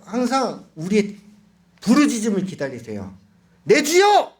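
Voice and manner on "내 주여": high, loud cry of prayer; a desperate shout of "내 주여!"; crying out to the Lord. Shown as a demonstration. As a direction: call out to God with everything. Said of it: "이렇게 소리 질렀는데".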